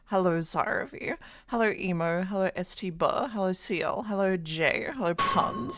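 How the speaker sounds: ordinary talk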